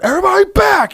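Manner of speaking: high, loud command; yelling out. Retold or imitated. The boss ordering the whole crowd back, yelled high and loud. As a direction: bark everyone back. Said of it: "you yelling out".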